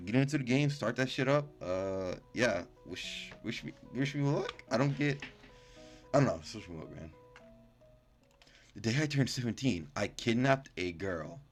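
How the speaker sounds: normal talking voice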